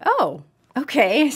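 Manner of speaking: playful, lifted reaction; mock realization. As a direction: act out catching sight of yourself. Acting out seeing oneself in mirror.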